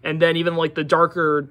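ordinary talk, nothing marked

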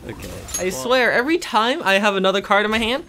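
ordinary delivery

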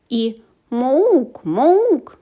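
loud high moo-ish call; a strange beast's bleat; creature call. A narrator's reading. Unseen creature calling in the girl's room, loud.

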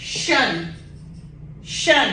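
"शन" with a sh sound, not s; the shun ending; syllable shun. Shown as a demonstration.

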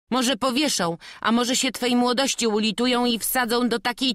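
high, loud, sneering villain voice; mocking thief. The thief Bartnik threatening Jasiek, high and loud with mockery. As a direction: taunt the boy with cruel glee.

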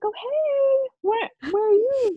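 high, fake-friendly sing-song hello; eager classmate greeting. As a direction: pitch it way up like a networking classmate. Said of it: "you see your classmates and you're like".

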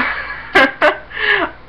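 high laugh tipping toward crying; laughing in spite of frustration; helpless laugh. Real, not acted. She laughs helplessly about her endless period, high and teary. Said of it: "I don't know why I'm laughing".

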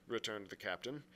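usual speaking voice

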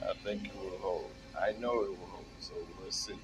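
usual speaking voice

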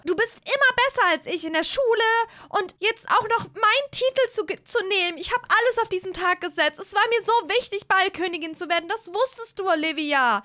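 high, heated tirade; angry accusation. Acted. Hazel accuses Olivia of stealing her title, furiously. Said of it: "über Schule streiten".

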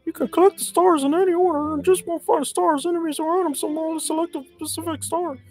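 very high squeaky falsetto, bursting in loud; Mickey Mouse. Acted. Player voicing Toad's hints about the stars, squeaky cartoon mouse. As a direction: read it all in a tiny cartoon mouse squeak. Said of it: "Should I do the Mickey Mouse voice again?".

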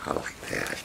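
soft whispered little monkey voice; monkey's whisper. Retold or imitated. Owner voicing her snuggled monkey in a whisper. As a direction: breathe it like a contented pet. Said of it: "Oh, Happy said".

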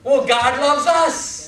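slow, high pulpit proclamation; emphatic proclamation. Real, not acted. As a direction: ring it out word by word. Preacher proclaims the sermon's point.

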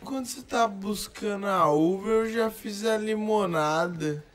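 slow, drawn-out proverb delivery; slow dramatic delivery. Shown as a demonstration. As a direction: stretch each word like a wise saying. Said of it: "repeats the saying".

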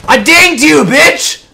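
high, loud gamer protest; indignant yell. Real, not acted. Gamer insisting to an opponent that the shot hit, indignant yell. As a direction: yell it at the enemy who survived.

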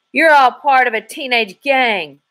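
high, loud, frantic accusation; hysterical howl. A narrator's reading. Thug accusing the girls in the loft, hysterical howl. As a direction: yell it, losing control. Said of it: "he howled hysterically".

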